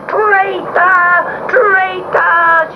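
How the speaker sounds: high shrieking witch voice; a defeated witch screaming at the kitten; witch's furious cry. A narrator's reading. Sea witch shrieking at the kitten as she vanishes. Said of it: "she cried".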